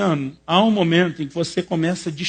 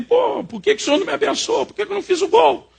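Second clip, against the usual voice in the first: pitched way up, whining at God; whiny grumbling; 4 dB louder than usual. Retold or imitated. Preacher retells griping at God over a missed goal, high whiny complaint. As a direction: complain like a sulking kid who missed the goal. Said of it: "E eu tava ali resmungando".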